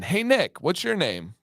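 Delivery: put-on high childish call, settling on the question; mock little-kid greeting; imitating little daughter. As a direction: open way up high like a kid calling a grown-up. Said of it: "this was Violet's line".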